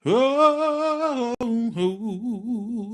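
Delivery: high drawn-out oh oh. Real, not acted. Drunken outburst ending the stream.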